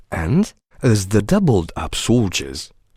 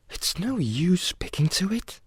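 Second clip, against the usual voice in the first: soft, high private remark; thinking to oneself; 8 semitones higher, 8 dB quieter than usual. A narrator's reading. Alice deciding not to answer the cat, private thought. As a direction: muse softly in a high voice. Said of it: "she thought".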